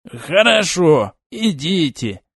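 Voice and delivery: put-on slow mumbled old mage voice; flustered old mage. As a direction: mumble it out, embarrassed. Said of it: "пробормотал он".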